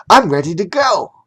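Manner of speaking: put-on high, perky little boy line; William's catchphrase. A narrator's reading. Narrator quoting William's favourite saying, lightly voiced boy. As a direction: chirp it like an eager kid. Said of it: "William loved to say".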